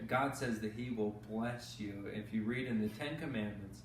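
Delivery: ordinary delivery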